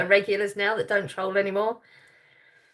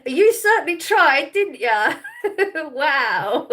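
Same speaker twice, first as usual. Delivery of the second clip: loud, higher, breaking into laughter; amused teasing; 5 semitones higher, 5 dB louder, slower than usual. Real, not acted. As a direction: tease them, then burst out laughing. Amused at a failed begging attempt.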